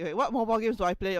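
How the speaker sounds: ordinary delivery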